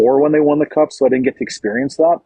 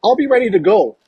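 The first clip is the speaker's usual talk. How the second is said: higher, hammed-up eager player; mock eager teammate; 7 semitones higher than usual. Host voicing a teammate answering MacKinnon, mock eager. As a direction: play a teammate overdoing enthusiasm. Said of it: "You'll be like".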